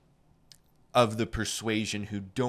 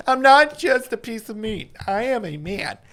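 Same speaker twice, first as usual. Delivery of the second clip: very high, hammed-up wail of indignation; over-the-top high-pitched outburst; mock melodramatic protest; 13 semitones higher, 9 dB louder than usual. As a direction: cry out like an overwrought film hero. Acts out melodramatic sound-bite protest.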